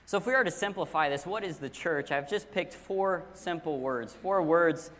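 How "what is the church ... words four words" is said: ordinary talk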